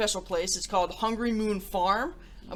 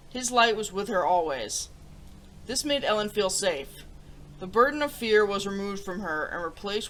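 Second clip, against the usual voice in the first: gentle storybook reading; storybook narrator voice; story narration; 2 semitones lower than usual. Acted. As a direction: read it like a bedtime story to kids. Story read aloud to viewers.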